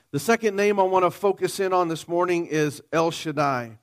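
usual speaking voice

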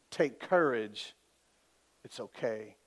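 slow, low and soft; gently steady frightened friends, unhurried and hushed; calm reassurance. Acted. Preacher voices Jesus calming terrified disciples.

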